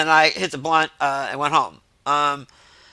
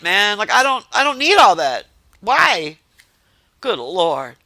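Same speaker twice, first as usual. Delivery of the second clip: exasperated disbelief; 7 semitones higher, 3 dB louder, slower than usual. Retold or imitated. Retelling own exasperation at friends.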